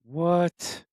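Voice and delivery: high, stunned "what?"; shocked what. Real, not acted. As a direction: blurt it out in disbelief. Stunned listener reaction.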